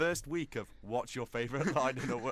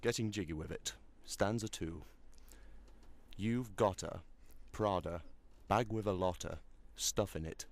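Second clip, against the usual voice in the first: reading voice; 6 semitones lower, 3 dB quieter than usual. Shown as a demonstration. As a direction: recite it like serious poetry. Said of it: "speak it in my reading voice".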